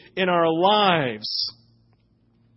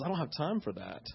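a much softer, muttered inner thought; quiet first thought; 12 dB quieter than usual. Retold or imitated. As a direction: say it under your breath like a private first reaction. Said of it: "And my first thought was".

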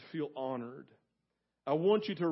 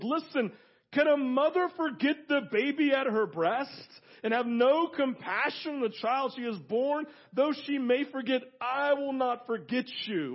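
higher-pitched, impassioned plea; insistent appeal; 7 semitones higher than usual. Acted. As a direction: press the point as God speaking. God's insistent appeal, acted out.

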